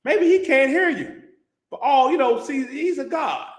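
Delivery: high, loud sarcastic taunting; mocking. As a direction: jeer at the false prophets. Elijah mocking Baal's prophets.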